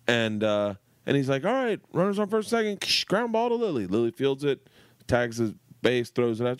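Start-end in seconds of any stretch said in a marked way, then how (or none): 1.4-3.9 higher, called out across the field; coach calling out. Retold or imitated. Coach calling a drill play to the girls, raised higher.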